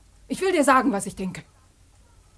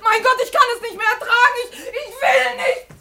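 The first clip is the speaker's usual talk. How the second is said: higher, crying out through sobs; sobbing outburst; 8 semitones higher than usual. Acted. Daughter breaking down in front of her parents, sobbing. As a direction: break down and sob it out.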